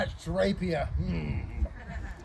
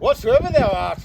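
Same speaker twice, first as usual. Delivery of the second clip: pompous letter reader; 4 semitones higher, 7 dB louder than usual. Acted. Reading a challenge letter aloud.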